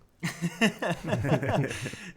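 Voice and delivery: loud delighted laugh; big burst of laughter. Real, not acted. Laughing hard as the host teases about proving Al wrong.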